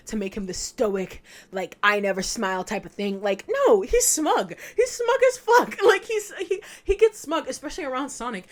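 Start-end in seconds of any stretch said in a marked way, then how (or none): 3.5-8.5 much higher and louder, laughing through it; real giddy fan excitement; excited rant. Real, not acted. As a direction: gush about the smug character. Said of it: "excited rant high pitch".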